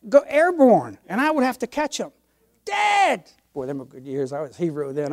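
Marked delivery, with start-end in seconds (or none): 2.6-3.3 put-on high, happy child's call; kids calling dad. Children greeting dad at the door, high call.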